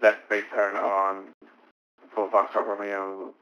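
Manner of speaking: usual speaking voice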